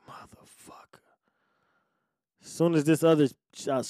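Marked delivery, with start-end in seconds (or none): 0.1-1.0 barely audible, voiceless swearing; whispered curse. Retold or imitated. Man reacting to losing his job, whispered curse. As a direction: whisper it under the breath.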